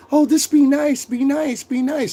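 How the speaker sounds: high mocking singsong nagging woman; mock woman's plea; mocking women. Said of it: "women that emphasize all the time".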